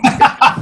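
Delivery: quick unvoiced laugh at the joke; amused breath of a laugh; short breathy laugh. Real, not acted. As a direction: let a short airy laugh out. Laughs at own quip.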